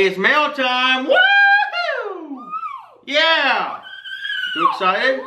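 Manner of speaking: excited high dog voice; mail time hype. Real, not acted. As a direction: pump the dog up with a squeal.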